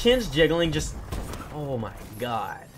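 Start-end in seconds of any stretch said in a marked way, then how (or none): none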